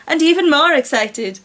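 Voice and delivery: ordinary delivery, nothing marked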